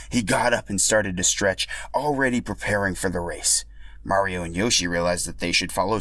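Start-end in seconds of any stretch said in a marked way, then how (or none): none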